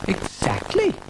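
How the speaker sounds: high little snail voice; officious little snail. A narrator's reading. Brian the snail objects to Dougal's caravan, high officious voice. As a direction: answer in a high, officious pipe. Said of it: "said Brian".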